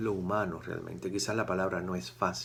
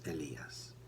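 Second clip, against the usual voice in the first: usual speaking voice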